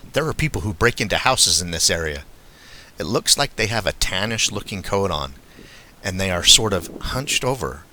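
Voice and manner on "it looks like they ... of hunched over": ordinary delivery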